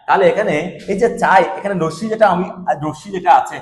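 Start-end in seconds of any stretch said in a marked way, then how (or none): none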